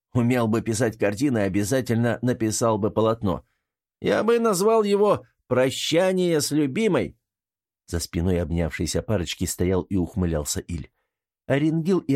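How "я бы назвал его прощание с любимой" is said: theatrical mockery, climbing high at the title; mocking. A narrator's reading. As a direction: sneer at the lovers like a grand critic. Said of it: "насмешливый голос".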